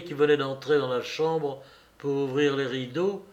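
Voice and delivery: ordinary delivery, nothing marked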